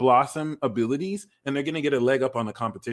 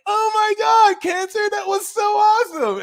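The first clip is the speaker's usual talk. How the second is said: very high, loud gushing put-on voice; mock awestruck admirer; mock amazed fan; 6 dB louder than usual. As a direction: gush like a starstruck stranger. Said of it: "they're gonna be like".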